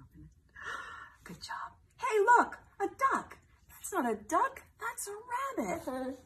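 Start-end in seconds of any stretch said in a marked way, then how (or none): 2.0-3.4 high, loud excited call; excited storybook voice. Acted. Duck-rabbit argument, duck side. 3.7-6.2 playful contradiction. Acted. Arguing the other side.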